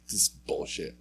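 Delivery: under the breath, voiceless and soft; muttered. Retold or imitated. Stopping her skating after the sticker, an irritated mutter. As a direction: mutter it to yourself in annoyance.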